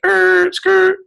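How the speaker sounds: high mock tire screech; imitated screeching halt. Reacting to a squeaky-voiced dude, high imitated skid. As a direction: screech to a stop with your voice. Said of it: "you're like".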